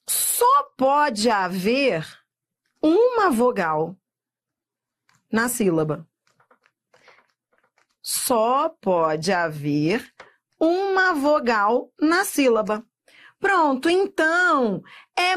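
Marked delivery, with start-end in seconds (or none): 0.1-6.1 slow, emphatic, broken by long pauses; mantra ditado. Shown as a demonstration. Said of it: "um mantra que você vai ter que repetir". 8.0-12.8 very slow, deliberate repetition; mantra repetido. Shown as a demonstration. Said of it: "um mantra que você vai ter que repetir".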